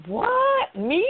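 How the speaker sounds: high-pitched feigned surprise; exaggerated, playful "who, me?" disbelief; mock innocence. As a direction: play innocent, pitch leaping way up. Feigning innocence when husband calls out sarcasm.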